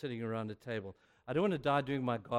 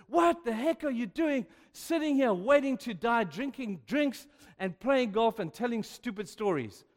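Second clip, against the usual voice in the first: angry rant; 10 semitones higher, 7 dB louder than usual. Retold or imitated. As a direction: let the frustration pour out. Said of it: "I began really angry".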